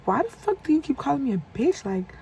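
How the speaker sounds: high-pitched indignant demand; mock furious retort; retold rowdy outburst. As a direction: snap back at him, fed up. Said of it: "that's when I started getting rowdy again".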